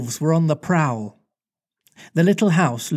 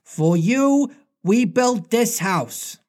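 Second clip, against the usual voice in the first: put-on boy's eager line; 6 semitones higher, slower than usual. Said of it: "he said rapidly".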